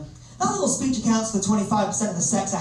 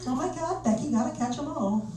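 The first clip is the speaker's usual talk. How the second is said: put-on high, hushed American girl voice; soft, high mock squeal to a friend; excited American girl; 7 semitones higher, 4 dB quieter than usual. New York girl to her friend on Tinder, mock excited and high. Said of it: "some girls in New York treat Tinder like it's Pokemon Go".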